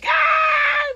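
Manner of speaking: very high squealed "ah"; playful scream. Pretend. Comic reacting to fans' hometowns, high playful scream. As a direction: let out a high mock scream. Screams after naming Baton Rouge.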